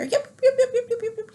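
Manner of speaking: very high, squeaky rapid yips; tiny dog yipping. Retold or imitated. Tiny caged dog during the smashing, high-pitched yipping imitation. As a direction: imitate a frantic little dog. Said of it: "It's a tiny dog".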